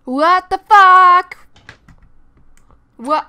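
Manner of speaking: loud, slow, drawn-out outraged yell; shocked shout. Real, not acted. Reacting to the sims, loud slow shocked yell. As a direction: blurt it out in disbelief.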